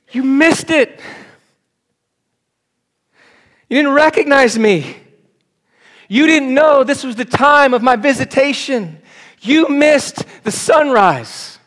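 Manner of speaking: high, loud, anguished cry of Jesus; Jesus lamenting. Acted. Jesus weeps over Jerusalem, crying out in high anguish. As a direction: plead like a grieving savior over the city.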